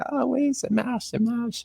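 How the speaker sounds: high, soft Quebecois staff voice; chirpy local waiter imitation; Quebec French accent. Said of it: "and they're like".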